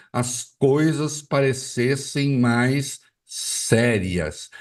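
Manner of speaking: very slow, every syllable spelled out; mock syllable-scanning. Shown as a demonstration. Mocking politicians who scan syllables, slowly. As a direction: drag each syllable to sound profound. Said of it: "as pessoas ficam escandindo sílabas".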